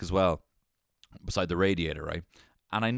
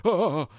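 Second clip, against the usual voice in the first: knockout grunt; 7 semitones higher, 6 dB louder than usual. Retold or imitated.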